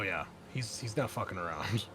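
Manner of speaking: ordinary talk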